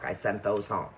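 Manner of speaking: ordinary talk, nothing marked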